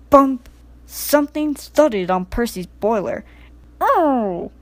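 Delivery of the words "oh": startled oh, muffled under a parachute; muffled exclamation. A narrator's reading. Percy hit by a parachute, muffled startled oh. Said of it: "he exclaimed in a muffled voice".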